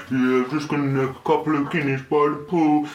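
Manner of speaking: casual boozy reply; mock Brit Dave. Dave planning Guinness by the pool, mock lazy.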